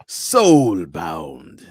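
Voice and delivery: low, loud, booming announcer. Shown as a demonstration. Host demonstrating a website sound clip, booming announcer voice. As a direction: stretch it out like a deep game announcer. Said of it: "like a vo".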